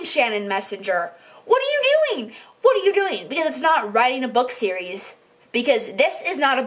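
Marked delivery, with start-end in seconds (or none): 1.4-2.3 much higher, pleading what are you doing; fed-up high cry of disbelief; exasperated high cry. Real, not acted. Rant aimed at the author. 2.6-3.3 higher, hitting what; exasperated. Real, not acted. Repeated at the author.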